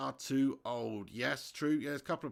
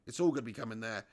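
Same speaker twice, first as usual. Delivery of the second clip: normal talking voice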